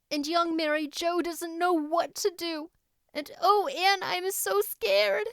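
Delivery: put-on high, anxious young girl's voice; a scared child pleading for help; frightened girl. A narrator's reading. Diana voiced in story.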